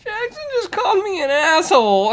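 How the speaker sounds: put-on high, loud tattling kid; hammed-up little boy telling on someone; Ollie. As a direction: wail it like an aggrieved child. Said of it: "And he goes".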